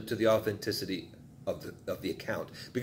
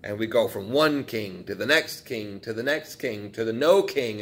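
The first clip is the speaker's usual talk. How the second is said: ordinary delivery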